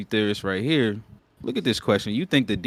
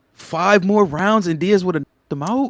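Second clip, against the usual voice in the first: incredulous; 9 semitones higher, 7 dB louder than usual. Real, not acted. Arguing the fight, disbelieving.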